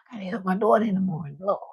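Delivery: fast whispered aside; quick hushed aside to the viewers. Real, not acted. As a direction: rush it out under your breath.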